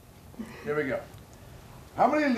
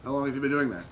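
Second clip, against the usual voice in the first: game show host; 2 semitones lower than usual. Acted.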